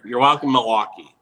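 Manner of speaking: mock grand proclamation. A radio host thanking his city, hammed-up grandly. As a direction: say it like a hero bowing to the crowd.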